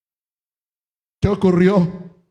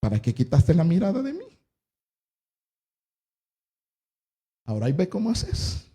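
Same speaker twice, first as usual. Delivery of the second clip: soft, offhand questioning brush-off; mock indifference; 7 dB quieter than usual. God hypothetically answering sinking Peter, mock indifference. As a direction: play an uncaring God, softly shrugging it off. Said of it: "si Dios fuera indiferente".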